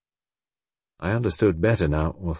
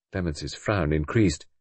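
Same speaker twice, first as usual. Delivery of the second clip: usual speaking voice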